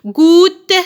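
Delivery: "Goutte" loud, in two beats; syllable by syllable. Shown as a demonstration. Teacher splitting word for pupils, loud drawn-out syllables. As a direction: draw out "Goutte", sounding the final e. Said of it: "vous découpez les syllabes".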